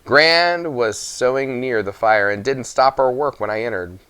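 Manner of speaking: plain reading voice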